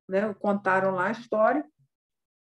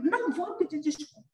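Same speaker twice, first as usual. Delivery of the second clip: stubborn refusal; 7 semitones higher, 3 dB quieter than usual. Retold or imitated. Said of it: "Como tá muito bravo".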